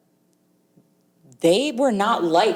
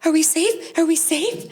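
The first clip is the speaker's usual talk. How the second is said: higher, anxious repeated question; nervous; 10 semitones higher than usual. Retold or imitated. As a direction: ask it twice, worried someone saw. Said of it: "And she's like".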